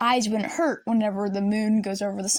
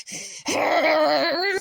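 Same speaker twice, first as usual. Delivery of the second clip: frustrated cry; 4 semitones higher than usual. Retold or imitated. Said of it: "I'm fuming mad".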